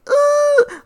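high, strained groan of sympathy; mock anguished groan; pained groan. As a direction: wince out loud. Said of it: "I'm just like".

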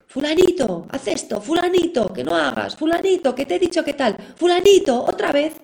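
imitating an exasperated parent nagging. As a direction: hammer the child's name like a nagging parent. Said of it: "no hacemos más que machacarlos".

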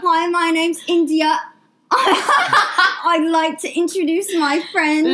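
put-on slow, posh English accent with a laugh; mock English accent. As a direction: play a prim English girl introducing a friend. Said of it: "English accents".

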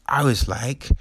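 ordinary talk, nothing marked

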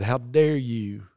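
drawn-out, loud indignant "How dare you"; slow angry challenge. Retold or imitated. Angry old farmer rebukes the preacher, slow and loud. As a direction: lean on "dare", slow and heavy.

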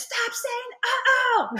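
put-on high, slow, loud whiny kid voice; a furious little boy scolding mom; mock toddler shout. Mother imitating her toddler, high and whiny.

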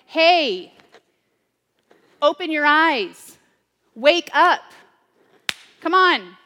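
blunt wake-up shout. Retold or imitated. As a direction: shake them awake with your voice. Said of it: "It might be like".

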